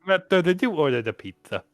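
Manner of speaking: a dim henchman asking his master; goon voice. Acted.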